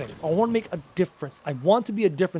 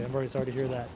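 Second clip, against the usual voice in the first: softer, lower and subdued; sombre condolence; 6 semitones lower, 9 dB quieter, faster than usual. Real, not acted. Streamer answers viewer whose mother has cancer, softly sympathetic. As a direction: drop into quiet sincere sympathy. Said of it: "I switched pretty quickly".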